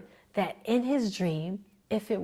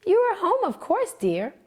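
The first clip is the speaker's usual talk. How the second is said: high, louder reassuring mother; mother's reply; 11 semitones higher, 3 dB louder than usual. A narrator's reading. Mother reassuring her waking son, high and sweet. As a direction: soothe him gently. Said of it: "replied his mother".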